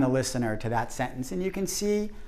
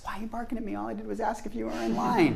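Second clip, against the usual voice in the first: higher, soft-edged whine of complaint; mock hurt protest; 6 semitones higher, 4 dB quieter, faster than usual. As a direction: protest innocently, pitched up. Hurt reply to being barked at.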